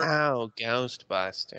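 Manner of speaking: slow drawn-out receptionist impression; Annie Potts. Co-host echoing the receptionist impression, slow mock drawl. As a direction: stretch both words like the Ghostbusters secretary. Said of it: "do a voice, the lady from Ghostbusters".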